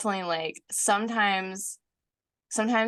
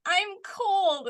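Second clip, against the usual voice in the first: high-pitched mock child's wail; imitating complaining child; 13 semitones higher, 3 dB louder than usual. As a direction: complain like a cold little kid, pitched way up. Voicing child complaining on walk.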